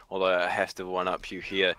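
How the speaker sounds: usual speaking voice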